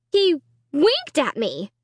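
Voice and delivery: slow, scared insistence; slow, shaken and high; frightened. Acted.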